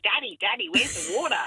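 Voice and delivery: imitating a small child's Australian accent; proper Australian accent. Mum imitating her four-year-old daughter, proper Australian accent. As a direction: call to Daddy in broad Australian. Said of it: "she had a proper Australian accent".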